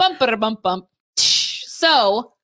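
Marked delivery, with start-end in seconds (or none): 0.0-0.9 mimicked joke rimshot; spoken drum sting. Host closing off a joke tangent, playful vocal drum sting. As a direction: tap out a comic drum fill with the voice.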